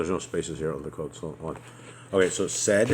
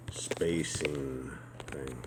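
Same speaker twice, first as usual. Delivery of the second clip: whispering; 4 semitones lower, 6 dB quieter, slower than usual. Real, not acted. Muttering to self while fixing.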